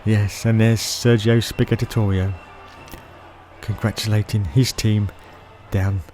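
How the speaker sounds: low, soft aside; subdued commentary. Pretend. Commentator watching the podium celebration, low and subdued. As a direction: let the commentary sink low and quiet.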